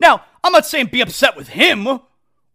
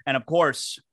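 beer ad read; 5 semitones lower than usual. Acted. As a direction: roll through the ad copy. Live sponsor read.

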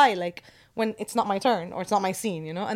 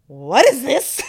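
louder astonished question with a laugh; retold amazement; 2 semitones higher, 7 dB louder, much slower than usual. Kid spots the poster in line, re-enacted loud amazement. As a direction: burst out in stunned wonder. Reliving first sight of poster.

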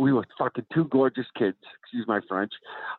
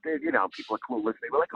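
ordinary talk, nothing marked

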